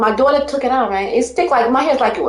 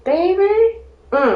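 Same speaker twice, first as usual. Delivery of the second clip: ordinary talk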